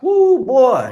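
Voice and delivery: high, loud, excited Southern drawl; Southern cat impression. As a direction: hype it up like a thrilled Southern radio guy. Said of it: "He's, he's Southern cat".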